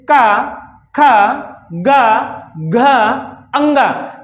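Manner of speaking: "का, खा, गा" pushed out from the throat; demonstrating throat sounds. Shown as a demonstration. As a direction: sound each of "का", "खा", "गा", "घा" firmly from the throat, one at a time. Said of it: "कंठ से आवाज निकलती है". (कंठ से ज़ोर देकर उच्चारण)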